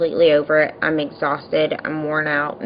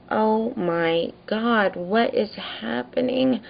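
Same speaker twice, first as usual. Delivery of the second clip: high, hushed, drawn-out disbelief; stunned disbelief; 5 semitones higher, 4 dB quieter, slower than usual. Retold or imitated. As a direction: gasp it softly, aghast at the scene. Her stunned reaction, retold.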